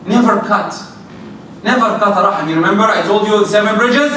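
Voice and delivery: ordinary talk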